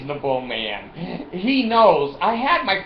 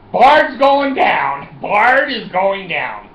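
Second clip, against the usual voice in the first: mock doom; 5 semitones higher, 9 dB louder, much slower than usual. Inner panic, retold thought.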